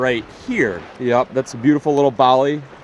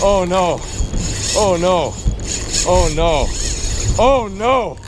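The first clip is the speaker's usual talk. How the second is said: high, repeated cries of dismay; helpless alarm as the fish runs; real alarm; 6 semitones higher, slower than usual. Real, not acted. Big fish runs with line.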